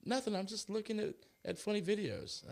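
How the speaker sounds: breezy, innocent wife brushing him off; playful impression of his wife brushing him off; imitating his wife. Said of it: "she's like".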